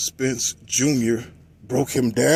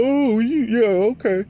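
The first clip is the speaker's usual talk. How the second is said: high-pitched imitation of backtracking critics; mock concession; 10 semitones higher, 3 dB louder than usual. Critics forced to take back their excuse, high mock voice. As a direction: send it up high, grudgingly giving in.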